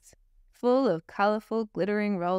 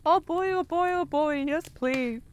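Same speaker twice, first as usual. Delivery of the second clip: excited whooping; 8 semitones higher than usual. A narrator's reading. Bear offered a job by Santa, excited whooping. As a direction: bounce with joy on each oh boy. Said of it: "Fluffy whooped with excitement".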